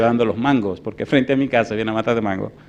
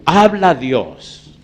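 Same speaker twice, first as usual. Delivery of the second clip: very slow, higher, ringing announcement; preacher's proclamation; 6 semitones higher, 9 dB louder, much slower than usual. Real, not acted. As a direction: proclaim it to the whole congregation. Announces God speaking. (proclamación solemne y lenta)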